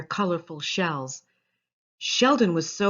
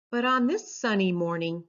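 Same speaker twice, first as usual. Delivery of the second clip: plain reading voice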